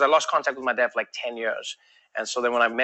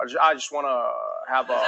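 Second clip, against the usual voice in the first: Barack Obama; 5 semitones lower than usual. Acted. English learner orders in America, sounding like Barack Obama. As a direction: drop the pitch and hesitate between phrases. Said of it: "like Barack Obama's voice".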